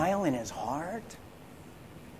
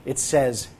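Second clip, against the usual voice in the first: ordinary talk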